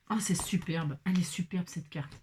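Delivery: soft, low murmur of admiration; admiring whisper. Real, not acted. Crafter admiring her own card, whispering. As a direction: breathe the praise, fading away at the end.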